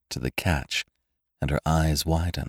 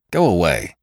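brusque dismissal; 4 dB louder than usual. A narrator's reading. Snaps at his sister mid-call.

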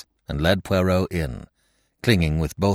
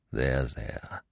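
low, much softer comforting murmur; soothing; 6 semitones lower, 8 dB quieter than usual. Acted. Poirot patting crying Martha's shoulder, soothing her. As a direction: hush her tears tenderly.

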